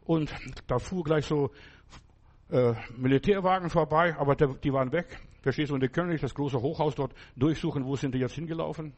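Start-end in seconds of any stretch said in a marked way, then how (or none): none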